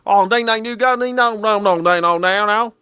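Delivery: high gibberish hillbilly twang; mock country old-timer accent. Host mocking the old man's speech, nonsense country accent. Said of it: "speak in that type of accent".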